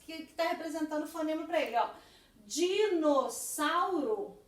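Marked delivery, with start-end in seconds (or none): 2.5-4.4 very slow, each syllable drawn out; stretched syllables. Shown as a demonstration. Teacher reading a child's word Dinossauro aloud, slowly stretched. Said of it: "faltou um".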